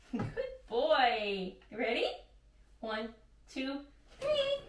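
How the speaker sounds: very high, soft sing-song puppy talk; talking to a dog. Real, not acted. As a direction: coax the puppy, sweet and squeaky. Training the puppy with treats.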